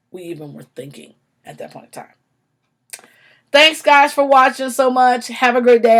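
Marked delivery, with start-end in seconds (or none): 3.5-6.0 cheerful sign-off. Real, not acted.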